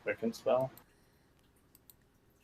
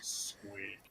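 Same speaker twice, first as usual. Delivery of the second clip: tiny soft squeak, lightly voiced; a quiet huffy ferret squeak; angry ferret squeak; 2 semitones lower, 12 dB quieter than usual. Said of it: "When he's angry".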